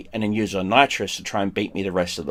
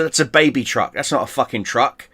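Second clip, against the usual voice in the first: ordinary talk, nothing marked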